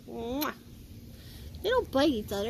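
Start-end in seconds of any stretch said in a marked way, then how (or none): none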